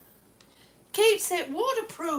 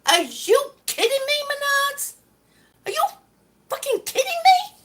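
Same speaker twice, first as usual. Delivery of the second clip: loud, high, outraged challenge; real angry disbelief; 8 semitones higher, 6 dB louder than usual. Real, not acted. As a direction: explode at the store in disbelief. Furious at smashed tile.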